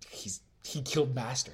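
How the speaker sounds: usual speaking voice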